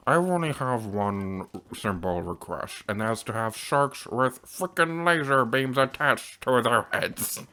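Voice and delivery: slow, drawn-out mock villain voice; a not-so-menacing monster villain, taking his time; Doctor Evil. Player imagining Kilton saying the movie line, slow Doctor Evil impression. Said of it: "makes him sound like Doctor Evil".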